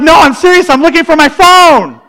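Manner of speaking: high, loud earnest protest; insistent younger self. Retold or imitated. Husband insisting to his wife on the phone, high and loud. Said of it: "I'm like".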